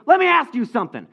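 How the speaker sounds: acted irate demand; angry challenge. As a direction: confront the caller loudly. [name] angrily challenges the caller.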